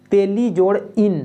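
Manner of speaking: slow, spelling out each part; slow breakdown. Shown as a demonstration. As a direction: take it apart slowly for the class. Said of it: "spells out word plus suffix".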